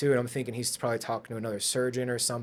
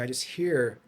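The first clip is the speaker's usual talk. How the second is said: ordinary delivery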